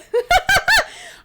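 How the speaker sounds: high, loud burst of laughter; helpless high cackle; real laugh. Real, not acted. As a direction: burst out laughing mid-read. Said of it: "I'm laughing because it's true".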